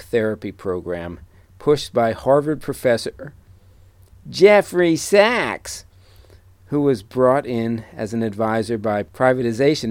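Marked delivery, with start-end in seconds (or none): 4.3-5.8 high, slow, drawn-out nasal whine; whiny nasal voice. Acted. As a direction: stretch the name in a whiny nasal tone. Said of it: "a weird, whiny, nasally voice".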